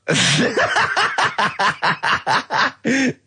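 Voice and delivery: a long burst of high laughter; laughing. Real, not acted. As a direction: laugh off the cheeky joke. Drop your trousers" joke.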